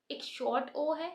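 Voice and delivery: usual speaking voice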